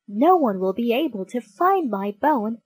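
plain reading voice

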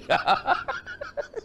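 high gloating cackle. Acted. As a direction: cackle in triumph over the board. Grandpa gloating over chess. (cười đắc thắng the thé)

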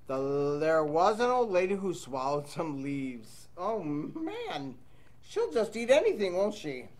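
ordinary delivery, nothing marked

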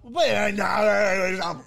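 slow, loud, stretched sales close; drawn-out shout. Retold or imitated. Mock salesman booming at the customer. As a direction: drag out the close like a pushy salesman.

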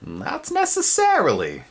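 high, slow, reedy insect voice; a creepy insect cutting in from the dark. A narrator's reading. Spindlebug surprising Twig, high slow insect voice. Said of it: "came a voice".

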